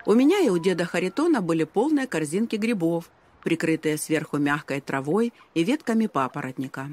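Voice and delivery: ordinary delivery, nothing marked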